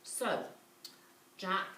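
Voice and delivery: ordinary delivery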